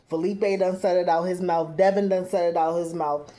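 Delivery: normal talking voice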